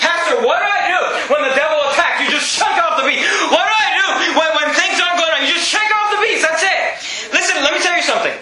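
high-pitched, urgent pulpit shouting; full-force preaching. Real, not acted. Pastor answering "what do I do when the devil attacks", full-force preaching. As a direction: drive it home to the congregation, pitch riding high.

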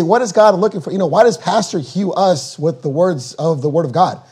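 normal talking voice

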